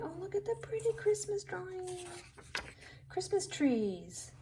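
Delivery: high quick excited gush. Real, not acted. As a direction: rush it out delighted. Delighted by a drawing.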